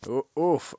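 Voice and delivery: wince. Real, not acted. Penguin falls.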